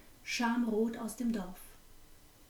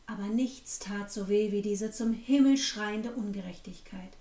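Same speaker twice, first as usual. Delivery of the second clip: plain reading voice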